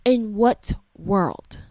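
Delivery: slow, spaced-out IN WHAT WORLD; slow incredulity. Real, not acted. Parent questioning a teacher's conduct, slow and incredulous. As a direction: stretch each word of "In what world" in disbelief. Repeats the outraged question slowly.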